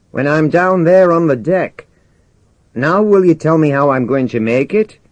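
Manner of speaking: put-on challenging young subordinate. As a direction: call down to the skipper, pressing for an answer. Voices Jimmy in dialogue.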